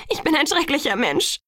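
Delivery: high, distraught self-accusation; hysterical. A narrator's reading. (hysterisch, mit hoher Stimme)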